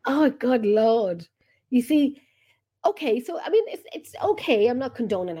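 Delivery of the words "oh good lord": ordinary talk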